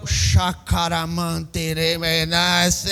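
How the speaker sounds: put-on low, slow garbled gibberish from a nervous boy; tongue-tied mumble. Pastor as a smitten teen mumbles gibberish at the girl's door. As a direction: mumble nonsense, too flustered to speak.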